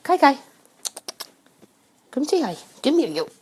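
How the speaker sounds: high cooing call to a pet; calling the cat. Real, not acted. Host calling her cat Kai Kai over, high pet voice. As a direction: sweet-talk the cat into coming.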